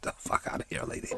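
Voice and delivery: unvoiced whisper, dismissive; scornful whispered aside; whispered scoff. Real, not acted. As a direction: breathe it out under your breath. Muttered aside at nurse.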